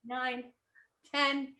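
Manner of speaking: steady fitness coach count; workout counting. Acted. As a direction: count reps for the class. Counting squats with class.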